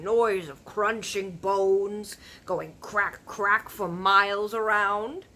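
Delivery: loud, slow, rumbling giant; big friendly giant warning about cannibal giants; BFG giant voice. Acted. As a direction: boom out the giant's grim warning. Giant's voice in story.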